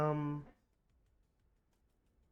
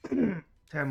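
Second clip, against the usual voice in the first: usual speaking voice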